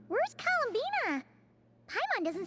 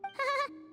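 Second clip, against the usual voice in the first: short cartoon giggle; brief squeaky titter; little chuckle; 4 semitones higher than usual. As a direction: chuckle like a small mascot. Mascot chuckles at the question.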